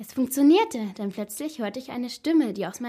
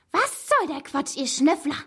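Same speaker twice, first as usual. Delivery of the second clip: angry snarl; 7 semitones higher, 4 dB louder than usual. A narrator's reading. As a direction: snarl at the snoopers in a high voice. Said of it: "fauchte Frau Neider". (wütend gefaucht, mit hoher Stimme)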